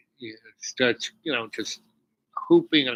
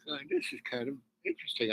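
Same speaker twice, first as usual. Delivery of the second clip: softer, fast, detached musing; detached aside; 10 dB quieter, much faster than usual. Retold or imitated. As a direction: mutter it to yourself, faster. Detached thought in tree.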